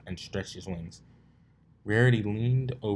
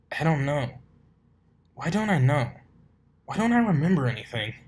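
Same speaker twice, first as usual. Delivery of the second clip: higher, loud desperate cry; panicked; 7 semitones higher, 6 dB louder than usual. Acted. Amnesiac colt panicking, high distressed cry. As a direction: grasp for memory in distress.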